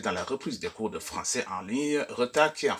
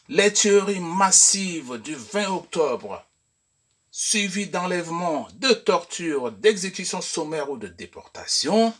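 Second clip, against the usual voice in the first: example read aloud; 5 semitones higher, 4 dB louder than usual. Shown as a demonstration. Reads example sentence aloud.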